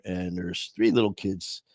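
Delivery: normal talking voice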